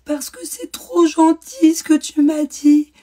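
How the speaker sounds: imitating a crying child. Mother imitating her son crying out why he weeps. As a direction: stretch it out like a boy sobbing. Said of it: "Il pleurait".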